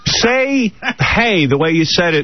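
high, drawn-out kid imitation; pleading kids. Kids asking a TV star for his line, eager high voices. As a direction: mimic children begging for a catchphrase. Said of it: "and then they go".

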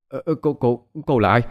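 a frightened man begging a ghost to keep away; terrified stammer. A narrator's reading. Frightened man stammering at a ghost, voice rising in panic. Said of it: "miệng khe khẽ nói".

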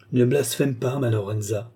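ordinary delivery

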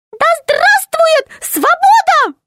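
very high, slow piping cry; a tiny gnome shouting freedom; thin gnome voice. A narrator's reading. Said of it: "тонкий, но отчётливый голосок гнома". (тонкий голосок гнома)

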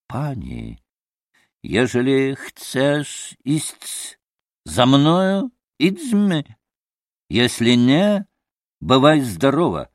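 slow, stately Polish-flavoured speech; dignified Polish pan with deliberate pauses; halting Polish-accented ultimatum, pitch climbing. Acted.

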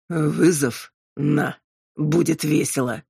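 slow demon voice, savouring it; demon, predatory relish. A narrator's reading. Demon relishing a challenge from Robin, slow and predatory.

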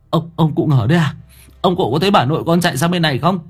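high, rushed panting question; out of breath. A narrator's reading. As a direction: blurt it fast after a long run. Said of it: "Thằng Long thở hồng hộc". (hỏi gấp, hụt hơi)

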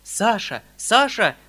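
a loud despairing call; mild despairing shout; desperate cry. Acted. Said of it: "кричал в отчаянии дядя".

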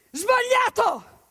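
a slow, high shouted verdict. Acted. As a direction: cut them off with one loud word. Narrator bursts in to correct. (grido secco e acuto)

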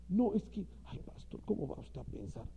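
whispered sheepish protest; hushed mock-innocent minister; coy denial. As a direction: feign innocence in a hushed breath.

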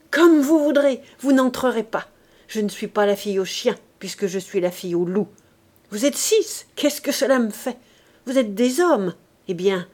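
put-on scornful young woman barring the door; defiant girl. Éponine defies the bandits.

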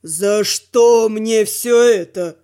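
long-suffering instructor, drawn out and low; exasperated sigh. A narrator's reading. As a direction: lament your fate as a weary teacher. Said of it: "Тронсен вздохнул".

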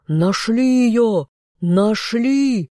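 high, slow triumphant shout. A narrator's reading. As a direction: call it out across the river. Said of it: "прокричали стражники".

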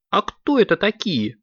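put-on high-pitched childlike question; high, curious kitten voice. A narrator's reading. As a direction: ask it high like a puzzled little kid. Kittens ask dad a question.

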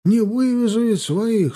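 plaintive protest climbing high; flustered. A narrator's reading. Peasant stuck with his cart, flustered protest. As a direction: protest helplessly, rising into a wail. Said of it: "суетился мужик".

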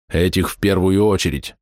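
usual speaking voice

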